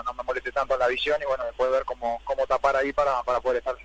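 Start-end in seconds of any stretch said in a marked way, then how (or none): none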